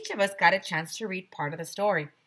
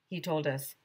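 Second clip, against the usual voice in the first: ordinary delivery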